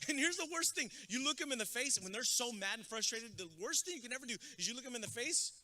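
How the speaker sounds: ordinary talk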